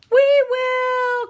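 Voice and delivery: high, loud shout together; loud joyful group shout; eager cry. Acted. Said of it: "cried Big Billy and Miss Marbles".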